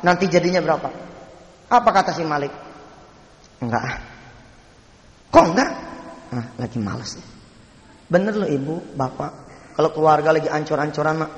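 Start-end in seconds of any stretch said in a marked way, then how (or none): 3.6-4.0 low, subdued brush-off; acted listless no; reluctant refusal. Malik reluctantly turns down job. 5.3-5.7 high, startled comeback; acted astonishment at a refusal; surprised questioning. 6.3-7.3 low, soft, unmotivated reply; acted lazy mumble of reluctance; listless excuse. Malik has no will to work.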